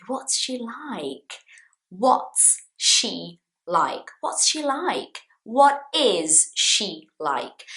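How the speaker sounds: drawn out at x0.5, leaning on "What's she like?"; slow, stressed question. Shown as a demonstration. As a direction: ask it slowly, word by word. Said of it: "This question is, I am asking you about".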